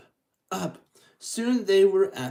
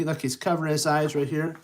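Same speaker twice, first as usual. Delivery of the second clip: ordinary delivery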